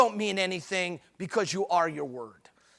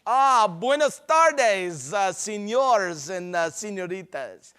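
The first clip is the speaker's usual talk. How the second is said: mock Spanish. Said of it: "It's like speaking Spanish".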